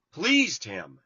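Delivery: ordinary delivery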